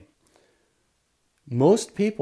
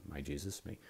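much softer, lower, under the breath; murmured prayer; 5 semitones lower, 14 dB quieter than usual. Pretend. Answering an insult with a prayer, murmured very softly. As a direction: pray it inwardly to yourself.

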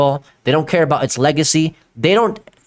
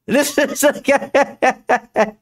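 words dissolving into high laughter; laughing in disbelief; 8 semitones higher, much slower than usual. Real, not acted. Streamer mocking the guy on screen, laughing helplessly. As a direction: say it slowly through a long laugh.

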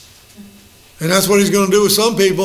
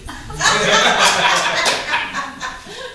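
high laughing burst; 9 semitones higher than usual. Real, not acted. Laughing at own joke.

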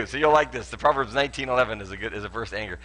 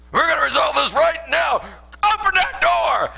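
bang on the door and shout it; mock demand; 13 semitones higher, 7 dB louder than usual. Husband demanding to settle a fight now, loud mock shout. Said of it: "Has it ever worked?".

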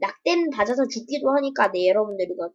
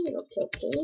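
whisper; 10 dB quieter, faster than usual. Real, not acted. Whispered repeat while placing blocks.

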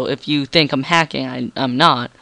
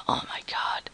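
whispering; 13 dB quieter than usual. Real, not acted. Whisper as upload finishes.

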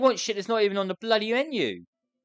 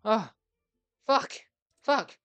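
normal talking voice

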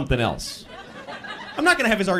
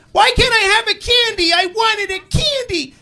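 put-on high, loud whine of a spoilt child; bratty kid; 4 dB louder than usual. Kid demanding candy from a parent, bratty high whine. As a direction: imitate a kid's tantrum, stretching the end. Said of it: "Your kid's being this shit".

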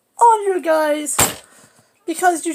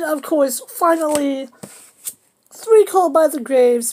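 normal talking voice

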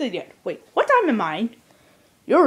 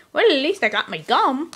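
normal talking voice

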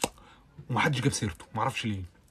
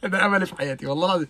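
laughing; 7 semitones higher, 5 dB louder, faster than usual. Real, not acted. Laughing confession of her wish.

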